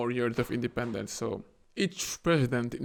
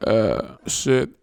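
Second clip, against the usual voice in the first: louder caught-out groan; loud hammed-up dismay; mock dismay; 3 semitones lower, 6 dB louder than usual. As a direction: realize you lost. Somalia government voiced caught out.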